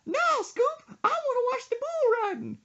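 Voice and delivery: put-on very high, loud old-lady squawk; caricatured grandma in a piercing falsetto. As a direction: holler back like an irritated grandma. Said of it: "She said".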